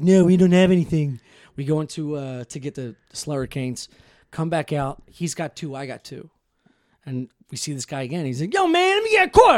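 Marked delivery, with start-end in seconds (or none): 0.0-1.2 louder, higher odd friend voice; Sam's weird voice. Retold or imitated. Quoting Sam refusing the mugger, in Sam's weird voice. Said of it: "he talk like this". 8.5-9.6 high, fast street hustler voice, pitched way up; street guy asking. Retold or imitated. Telling friends about a mugger, high pushy street voice.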